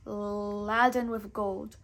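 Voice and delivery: ordinary delivery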